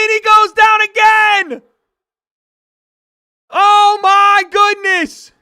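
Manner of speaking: breathless live yelling; excited shouting. Real, not acted. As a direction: yell every punch as he wobbles. Second knockdown called live.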